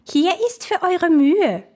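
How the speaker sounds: shrill-pitched mocking will-o'-wisps. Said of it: "riefen die Reisenden".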